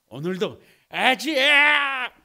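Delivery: rallying cry to himself during morning pull-ups; self-cheer shout. Retold or imitated. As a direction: pump himself up every morning, stretched out. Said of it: "막 이런단 말이에요".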